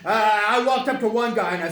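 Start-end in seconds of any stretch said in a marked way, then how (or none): none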